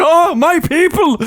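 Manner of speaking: high, drawn-out mock Irish cry; [name]'s fake Irish. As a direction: hail your people in fake Irish, slowly. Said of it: "she's like".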